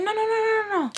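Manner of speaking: rapid high-pitched string of objections; imitated protest. Girl refusing the avocado as it's served, high imitated protest. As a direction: mimic a frantic, high refusal.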